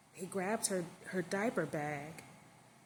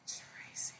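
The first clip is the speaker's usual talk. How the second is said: ordinary delivery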